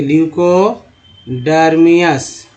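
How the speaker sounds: ल्यूकोडर्मियस drawn out, syllable by syllable, falling at the end; slow model pronunciation. Shown as a demonstration. Teacher showing students how to say a word, slowly. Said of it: "देखिए".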